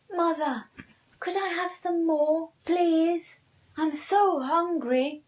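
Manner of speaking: put-on high, loud pleading boy; pleading Pinkle. Pinkle asking for more.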